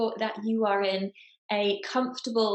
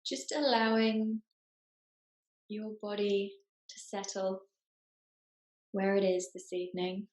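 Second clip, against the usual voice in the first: guided meditation. Acted. Meditation teacher settling the group into their bodies, guided meditation. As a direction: ease them into stillness, phrase by unhurried phrase.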